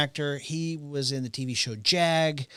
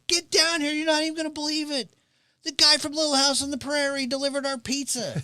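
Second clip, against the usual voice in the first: much higher, fast, excited call upstairs; excited woman calling; 13 semitones higher than usual. Retold or imitated. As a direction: shout up to the boyfriend in a giddy high voice. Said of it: "She's like".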